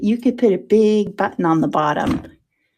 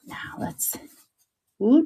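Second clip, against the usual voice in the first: ordinary delivery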